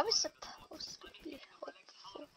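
breathy whispered aside. Real, not acted. Gamer whispering to viewers mid-game.

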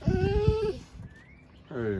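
very high squeaky hey. Real, not acted. Owner greeting the dog, very high squeak.

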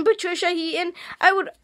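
ordinary delivery

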